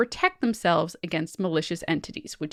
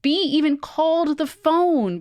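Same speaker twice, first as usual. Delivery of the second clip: ordinary delivery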